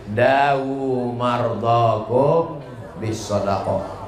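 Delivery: slow, solemn recitation; slow reverent chant of a hadith; recited hadith. Acted. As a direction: intone the saying of the Prophet slowly. Reciting the hadith slowly again.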